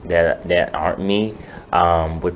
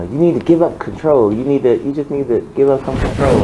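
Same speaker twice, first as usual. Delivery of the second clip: higher-pitched, insistent retelling of advice; imitating mother's nagging; 6 semitones higher, 3 dB louder than usual. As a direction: press the point like a nagging mother. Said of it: "she tells me all the time".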